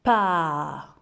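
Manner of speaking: Pa said loud and clear; teacher modelling a syllable; loud syllable demo. Shown as a demonstration. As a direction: call out Pa for the class. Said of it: "Vous redites avec moi?".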